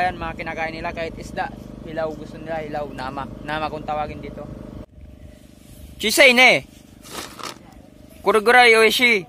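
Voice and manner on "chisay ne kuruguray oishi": loud, higher-pitched calling out; pasigaw na pagtawag. Real, not acted. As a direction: call it out loudly in Japanese. Calls out in Japanese to companion. (malakas at mataas na pagsigaw sa wikang Hapon)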